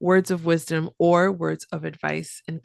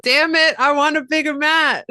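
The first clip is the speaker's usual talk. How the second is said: put-on loud playful kid whine; mock whiny demand; 8 semitones higher, 8 dB louder than usual. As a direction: stamp your foot and demand it. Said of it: "echoes the quote playfully".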